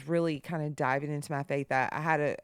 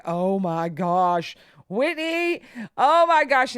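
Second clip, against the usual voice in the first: loud, drawn-out, high exclamation; retold horror; 5 semitones higher, 8 dB louder, slower than usual. Grandmother to daughter over screaming child, loud acted horror. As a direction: re-enact a horrified mother, rising to a loud cry.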